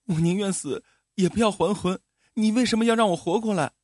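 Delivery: high, fast, tearful outburst; crying. A narrator's reading. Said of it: "江离哭着说". (带着哭腔，又高又快地哭诉)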